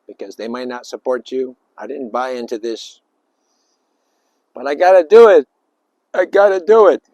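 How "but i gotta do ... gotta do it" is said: loud, higher, exasperated "I gotta do it"; a mock grudging shout; reluctant outburst. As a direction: blurt it out like someone forced into it. Voicing a reluctant person.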